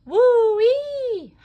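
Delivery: high, loud cowgirl howl; howl of joy. A narrator's reading. Jessie celebrating the full set, high loud howl. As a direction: holler with glee. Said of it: "howled Jessie".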